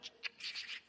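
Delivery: whispered shh; urgent hush to keep them quiet. Acted. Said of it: "motioning with his hand to keep silent".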